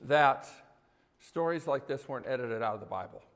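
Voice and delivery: low, soft praying voice; prayer. Real, not acted. Pastor asking the Lord for help before the sermon, low hushed prayer. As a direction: pray quietly and earnestly, well below preaching pitch.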